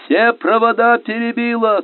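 high yell from the bow; shouting. A narrator's reading. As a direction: shout a damage report across the boat. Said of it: "кричал минёр с носа".

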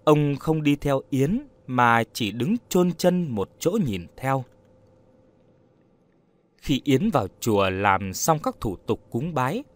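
plain reading voice

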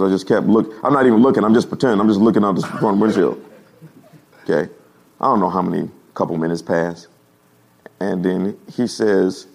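ordinary talk

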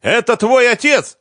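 high, loud furious yell; enraged denunciation to the child; spitting rage. A narrator's reading. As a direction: spit out the accusation at screaming pitch. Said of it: "брызгала слюной Лиза".

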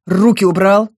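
sharp loud bark; barked order. A narrator's reading. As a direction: snap it out loud and angry. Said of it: "рявкнула я".

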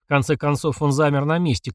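ordinary delivery, nothing marked

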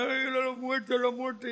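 hammed-up fake wail over a death; mock wailing. Mourners faking grief over the girl, mock wail. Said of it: "estaban llorando".

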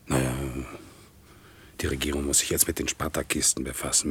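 subdued, private reckoning; brooding inner monologue. Acted. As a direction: think aloud to yourself with long pauses. Private monologue after the hearing.

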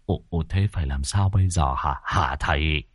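hushed, low, stammered question; stammering. A narrator's reading. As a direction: falter and repeat yourself, asking the master low and soft. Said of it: "Cụ Mốc nhìn cậu lắp bắp".

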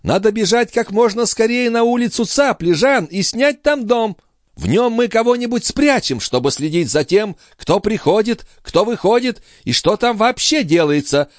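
high-pitched lady listing demands; eager countess. Acted. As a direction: press urgently, in a high voice.

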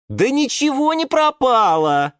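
loud, high indignant retort. A narrator's reading. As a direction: brush off the despair indignantly. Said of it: "возмутилась Пальма Мальва". (громко, возмущённо)

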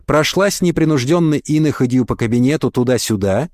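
usual speaking voice

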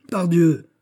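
high, loud indignant oath; worked-up anger. A narrator's reading. Prince brushing off fear of scandal, angry outburst. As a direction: burst out with it, exasperated. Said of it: "en s'excitant lui-même".

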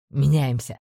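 low, curt commander order; commanding order. A narrator's reading. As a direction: give the order low and firm. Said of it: "приказал Блайнер".

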